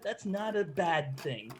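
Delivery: normal talking voice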